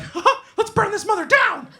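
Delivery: loud, very high cartoon falsetto; rowdy mouse impression. Drunker fan hiding behind the mascot, rowdy mouse falsetto. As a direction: shriek it in a squeaky mascot voice.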